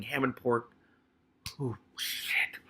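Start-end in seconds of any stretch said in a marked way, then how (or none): 1.6-2.6 real whispered aside; whispered curse. Real, not acted. Reader cursing softly under their breath.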